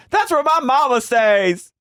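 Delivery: very high-pitched wailing imitation; hammed-up high wail; mock outrage. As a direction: cry it out as her, indignant. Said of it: "she goes".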